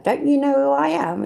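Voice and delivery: high, haughty whine of self-importance; mock entitled guy. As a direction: play a pompous show-off. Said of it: "It's one of them, innit?".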